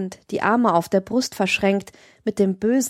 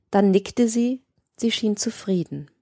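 usual speaking voice

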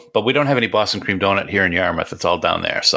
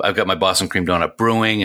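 usual speaking voice

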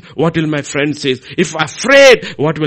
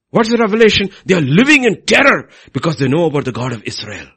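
ordinary delivery

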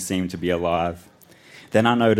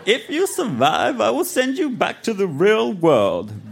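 high, theatrical villain voice; undead lord; 11 semitones higher than usual. A narrator's reading. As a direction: proclaim the terms like an evil overlord. Undead lord sets the challenge.

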